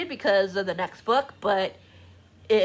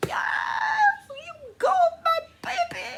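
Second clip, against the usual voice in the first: much higher, squealing cheer; cheering her on. Retold or imitated. Vlogger reliving her reaction to the hero's words, high squealing cheer. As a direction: cheer the heroine on like a proud fan. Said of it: "I'm like".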